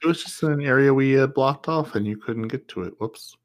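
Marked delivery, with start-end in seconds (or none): none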